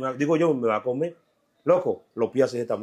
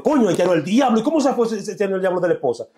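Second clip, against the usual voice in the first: astonished cop; 10 semitones higher, 4 dB louder than usual. Retold or imitated. Neighbor quotes amazed policeman.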